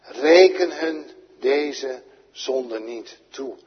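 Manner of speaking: loud, slow crying-out prayer. A narrator's reading. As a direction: cry it out with a great voice, slowly. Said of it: "riep hij met grote stem".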